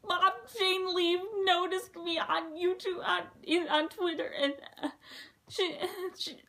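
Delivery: a reenacted half-crying gush; imitating sobbing. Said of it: "started sobbing".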